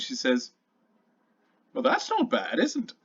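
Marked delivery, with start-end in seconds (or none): 1.7-3.0 high, pleased shopkeeper voice. Acted. Shopkeeper admiring the necklace on the panther. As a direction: admire your handiwork aloud.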